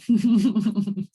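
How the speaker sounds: a quick embarrassed laugh, loud and bright; short real laugh. Real, not acted. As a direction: laugh it off at yourself. Laughs at own habit.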